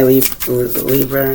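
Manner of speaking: ordinary delivery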